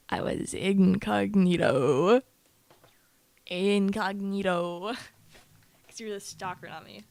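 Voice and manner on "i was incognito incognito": very slow, stretched INCOGNITO; low, loud, stretched-out delivery; drawn-out incognito. Pretend. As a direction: draw out every syllable like a mock announcement. Playful drawn-out boast.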